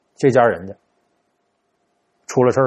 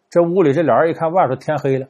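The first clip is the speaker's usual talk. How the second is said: ordinary delivery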